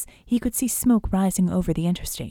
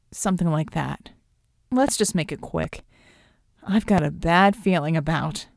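low, uneasy reluctant voice; reluctant partner; 4 semitones lower than usual. A narrator's reading. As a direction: agree uneasily, pitch kept low. Voices Klaus, queasy and reluctant.

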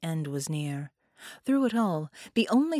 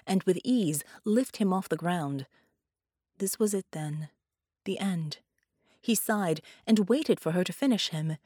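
plain reading voice

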